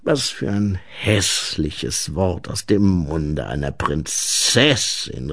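leicht angedeuteter tiefer Gnom; tief, mit etwas gespielter Entrüstung; empörter Gnom. A narrator's reading. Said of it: "rief der Gnom". (leicht empörte Gnomenstimme)

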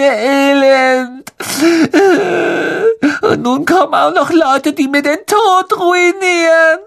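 high, slow, tearful wail; self-pitying whine. Acted. Myrte to Hermine, slow, high and tearful. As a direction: drown in pity for herself.

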